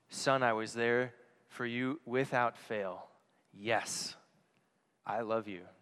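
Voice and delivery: slow, soft, low reassurance; tender dad. Retold or imitated. Father reassuring his son, slow and soft. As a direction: let it settle gently like a loving father.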